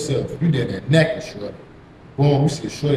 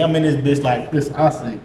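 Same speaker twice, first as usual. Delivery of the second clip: usual speaking voice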